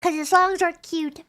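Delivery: normal talking voice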